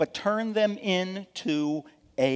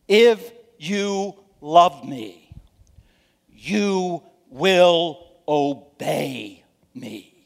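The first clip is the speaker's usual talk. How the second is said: slow, solemn words of Jesus, louder; quoting Jesus; 6 dB louder, much slower than usual. Acted. Preacher voices Jesus' command to the congregation, solemn and slow. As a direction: give Jesus' words slowly with weight. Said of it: "Jesus said".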